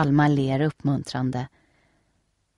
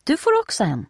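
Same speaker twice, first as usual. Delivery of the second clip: put-on louder, higher happy girl; glad; 10 semitones higher, 4 dB louder than usual. A narrator's reading. Nelly tells Steffi happily, louder and higher. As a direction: say it cheerfully, pleased.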